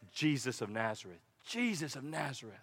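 low, soft repeated name; hushed answer. Acted. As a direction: drop low and say it softly. Voicing the answer Bartimaeus hears.

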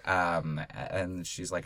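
ordinary talk, nothing marked

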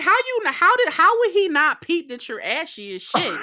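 very high, loud disbelief; mocking a TV plot hole, high incredulous squeal; incredulous shriek. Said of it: "I'm like".

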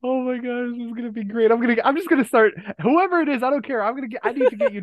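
excited. Real, not acted. Excited about the impression bit.

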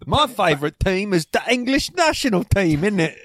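high, hammed-up British football fan; British accent. American pretending to be English to locals, hammed-up British accent. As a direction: play an English footie fan. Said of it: "if you go to London".

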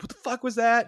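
high, loud retold outburst; affronted challenge. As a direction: imitate an incredulous, offended thug. Mugger turns round, retold challenge.

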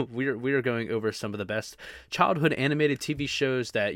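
usual speaking voice

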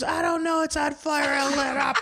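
high laughing mock panic; hammed-up high panicked neighbor. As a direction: blurt it like a flustered neighbor. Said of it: "She goes".

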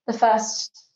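ordinary talk

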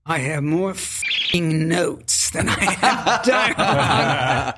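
put-on slow English-accented grumble; Timothy Dalton impression. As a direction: drawl it out like a weary English actor. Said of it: "So he said to me".

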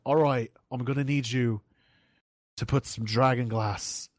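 halting attempt at a northern English Game of Thrones accent; Jon Snow accent. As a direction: put on an amateur northern English accent, pausing between phrases. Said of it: "I love the Jon Snow accent".

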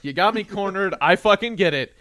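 high, loud rant at fate; mock exasperation. Man cornered by a snagged wire, loud mock exasperation. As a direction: give in loudly to a hostile world.

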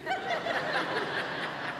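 soft airy laugh, high and quiet; breathless laugh. Real, not acted. Pastor telling the congregation about the misprinted shirts, laughing softly.

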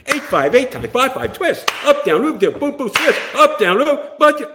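high, loud rapid-fire commands; choreographer calling counts. Retold or imitated. Choreographer drilling a Fosse routine, high urgent shouting. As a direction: shout the dance moves in rhythm.

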